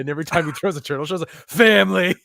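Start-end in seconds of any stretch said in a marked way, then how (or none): 1.5-2.1 "Family" said loud and high as Dom. Pretend. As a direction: belt "Family" like Dom the racer. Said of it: "you just have Dom with the big head".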